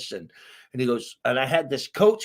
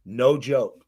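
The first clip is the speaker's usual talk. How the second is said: ordinary delivery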